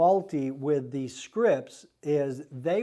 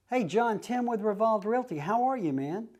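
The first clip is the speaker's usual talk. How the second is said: higher, breezy hey-buddy greeting; casual familiar greeting; 6 semitones higher than usual. Shown as a demonstration. Realtor cold-calling a seller, casual friendly voice. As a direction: greet him like an old friend. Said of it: "You see what I did there? The tone of my voice".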